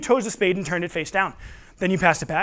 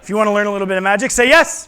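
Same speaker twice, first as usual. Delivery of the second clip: put-on loud, coaxing call to the kids; rally the crowd to answer; kids party magician; 5 dB louder, faster than usual. Calling kids to shout yes.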